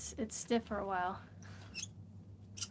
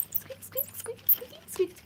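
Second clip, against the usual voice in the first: tiny squeaky mouse voice, much higher; 4 dB quieter, slower than usual. Pretend. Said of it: "I'm talking mouse to you".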